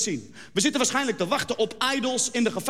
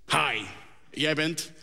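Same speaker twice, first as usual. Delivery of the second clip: jury member; 8 semitones lower, 5 dB quieter, much slower than usual. Acted. Idols jury asks the contestant.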